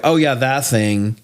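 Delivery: ordinary talk, nothing marked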